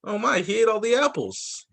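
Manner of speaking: ordinary talk, nothing marked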